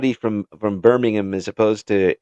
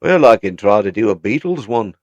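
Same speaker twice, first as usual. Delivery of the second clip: Liverpool accent; 3 dB louder than usual. Shown as a demonstration. Guest tries a British accent for the streamer on request, Liverpool accent. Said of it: "you sounded very [name]".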